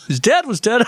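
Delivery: high-pitched, amused disbelief; incredulous. Real, not acted. Co-host catches the dead-dad gaffe, incredulous. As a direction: ask it high, about to crack up.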